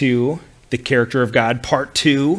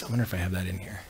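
low, much softer, to oneself; muttered aside; 5 semitones lower, 12 dB quieter, much faster than usual. Real, not acted. Teacher checking the notes for grace, muttered aside. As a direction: drop right down to wonder aloud.

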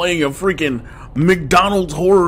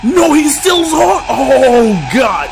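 loud, high panic; frantic, scared outcry; being chased; 7 semitones higher, 5 dB louder, slower than usual. Real, not acted.

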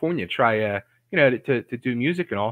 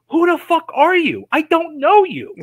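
much higher, indignant mock outburst; imitating fed-up rocker; 12 semitones higher, 4 dB louder than usual. Rocker finally rejecting a pestering caller, retold as a high mock tirade. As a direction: blow up at the caller, half laughing.

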